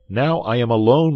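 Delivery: high, loud call to a friend. A narrator's reading. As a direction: call through a closed door. Calling to friend behind door.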